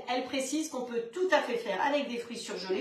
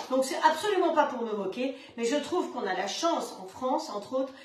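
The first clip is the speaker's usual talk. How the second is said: usual speaking voice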